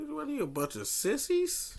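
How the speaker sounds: lightly voiced April O'Neil, mocking; imitating April O'Neil.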